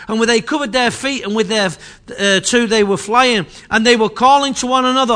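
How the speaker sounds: loud, fervent preaching read of scripture; preaching at full force. Acted. Preacher reading Isaiah to the church, loud and fervent. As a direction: proclaim it to the whole congregation.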